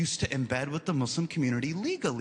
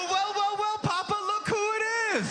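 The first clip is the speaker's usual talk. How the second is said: gloating villain; 13 semitones higher than usual. Retold or imitated. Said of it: "I go".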